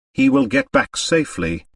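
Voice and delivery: light woman's voice, cool and sure; lightly voiced Olga. Olga reassuring the men about the American, lightly voiced woman. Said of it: "Olga's voice in the story".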